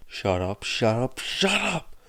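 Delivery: builds from plain to high shout; escalating shout. A narrator's reading. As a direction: Midoriya snapping under pressure. Escalating outburst.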